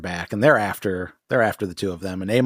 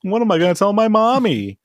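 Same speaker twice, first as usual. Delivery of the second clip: put-on high, whiny little-kid voice; mock crybaby whine; 8 semitones higher, 4 dB louder than usual. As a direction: whine like a child running to mom. Villain mocks the dead rival.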